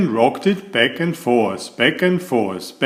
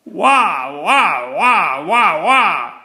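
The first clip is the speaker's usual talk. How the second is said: put-on screaming baby; 5 semitones higher, slower than usual. A narrator's reading. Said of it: "It screamed really loud".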